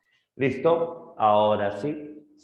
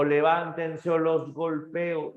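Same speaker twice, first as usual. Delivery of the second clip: higher, slow mock threat; threatening grandfather; 8 semitones higher, slower than usual. Grandfather threatens the boys, slow mock menace. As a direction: drag out the threat. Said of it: "Y entonces dijo".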